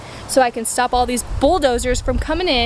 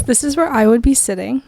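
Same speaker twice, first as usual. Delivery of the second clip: ordinary talk, nothing marked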